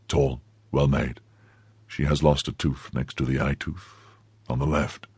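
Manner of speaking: low, soft, measured recall; subdued questioning. Acted. Stricken musketeer checks her description, subdued. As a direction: list her features quietly and heavily.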